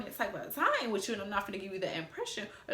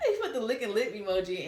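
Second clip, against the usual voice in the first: laughing while talking; 3 semitones higher than usual. Real, not acted. Laughs retelling emoji.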